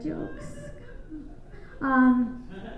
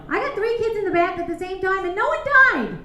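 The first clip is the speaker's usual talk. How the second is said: climbing higher and louder to a shout; triumphant brag; 7 semitones higher, 5 dB louder than usual. Real, not acted. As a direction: brag it out proudly. Bragging, rising to shout.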